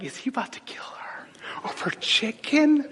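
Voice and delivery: hushed whispered aside; whispered worry at a Popeyes counter; mock whisper. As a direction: whisper it like gossip at the next table. Retold worried thought at restaurant.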